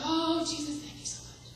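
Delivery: high "Oh, Jesus", then "thank you so much" breathed out as a whisper; lightly mock the youth group's fake praise; mild parody of pious gratitude, fading to a whisper; mock gushing worship. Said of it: "they'd be up there going".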